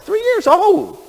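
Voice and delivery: high, loud outraged exclamation; incredulous. Acted. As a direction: stretch it out in high-pitched disbelief. Repeats it in mock outrage.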